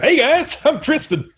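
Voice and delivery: high, chirpy mock greeting; mock new guy. Game master teases the misnamed character with a high mock greeting. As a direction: pipe it up like a goofy newcomer.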